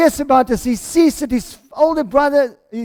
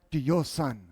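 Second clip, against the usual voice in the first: quiet, low emphasis on "your"; stress on YOUR; 8 semitones lower, 11 dB quieter than usual. Acted. Older brother refusing to say my brother, stress on "your". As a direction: set "your" apart, dropping low and soft. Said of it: "did you hear the language he says".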